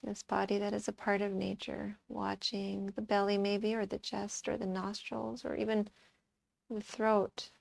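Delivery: soft, calm meditation voice; guided meditation. Acted. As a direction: guide them quietly into watching the breath, with long pauses. Leads a breath meditation.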